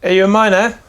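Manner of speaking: dwarf smith questioning the customer; suspicious dwarf. Acted. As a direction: press the question. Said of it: "He looks over and goes".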